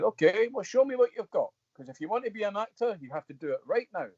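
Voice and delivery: a hint of a mentor putting him on the spot; Ian Bannon challenging. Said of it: "And he said".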